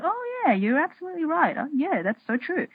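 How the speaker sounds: high, bright oh yeah of realization; a sudden high lift of relief; delighted agreement. Retold or imitated. Her relieved self agreeing with her best friend's words.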